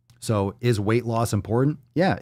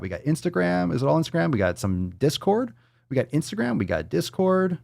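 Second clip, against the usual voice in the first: lilting, pitched-up listing; high sing-song; 7 semitones higher than usual. Real, not acted. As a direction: list them in a playful lilt.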